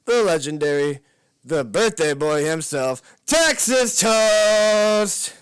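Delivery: big ring-announcer introduction, building to a long held shout on the name; hype announcer. Pretend. Host introducing the birthday guest, hyped announcer build. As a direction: announce it like a fight card, stretching the last name out loud.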